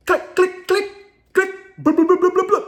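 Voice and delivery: high clicks into a loud rattling burst; mouth-made pistol clicks and shots; imitated pistol noises. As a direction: mimic a gun clicking, then firing. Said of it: "And Leon does like this".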